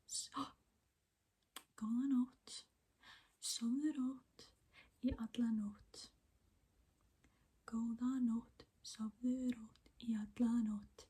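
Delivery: soft whispered ASMR. Real, not acted. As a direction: whisper close to the mic.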